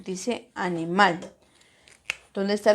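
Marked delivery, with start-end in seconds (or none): 0.5-1.2 "animal" correctly stressed as ani-MAL; stress on last syllable. Shown as a demonstration. Teacher shows the right way, "animal" stressed on MAL. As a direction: put the weight on final MAL in "animal". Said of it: "la mayor fuerza de voz en mal".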